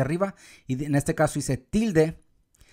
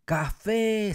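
"café" with the final é pushed higher; stress on last syllable; 6 semitones higher than usual. Shown as a demonstration. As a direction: call out café, leaning on the é. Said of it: "se escuchó más fuerte aquí".

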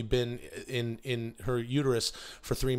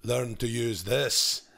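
Uncle Angus from Braveheart; much slower than usual. Acted. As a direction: deliver the lesson in slow, weighty phrases. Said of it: "Uncle Angus from, uh, Braveheart".

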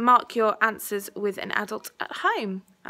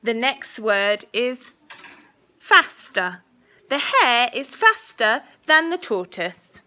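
slow, clear dictation voice; school dictation; 3 semitones higher, 7 dB louder, much slower than usual. Acted. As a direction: say each word carefully for spelling. Teacher dictating a spelling test.

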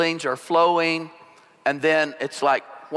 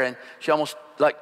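ordinary talk

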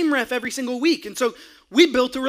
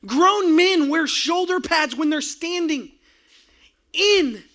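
rising preaching; 3 semitones higher than usual. Acted. Pastor preaching to the congregation about passion, building to a shout. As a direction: build the sermon to a roar.

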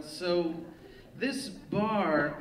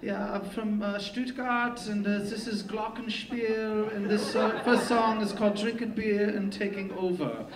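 put-on worst German accent; 6 semitones higher than usual. Fake band frontman from Stuttgart greets a bar, bad German accent. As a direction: drag out a terrible German accent. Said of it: "I did the worst accent".

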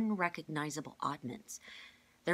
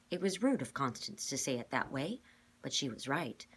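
ordinary delivery

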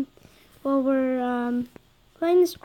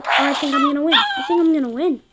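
excited; 4 semitones higher, 6 dB louder, faster than usual. Real, not acted. Excited about winning.

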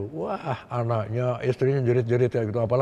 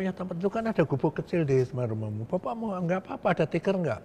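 polite request; 6 semitones higher than usual. Retold or imitated. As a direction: ask humbly for a mat. Asks rebel for a place to sleep.

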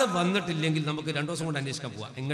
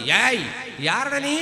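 higher, loud, odd questioning; mock-mad challenge; 5 semitones higher, 7 dB louder, much slower than usual. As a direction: play dumb and strange. Feigned madness to father. (ഭ്രാന്ത് അഭിനയിച്ച് ഉയർന്ന ശബ്ദത്തിൽ)

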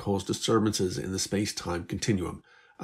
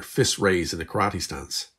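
ordinary delivery, nothing marked

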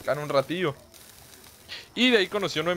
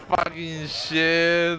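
drawn-out groan; much slower than usual. Pretend. Reacts to a viewer's sad story.

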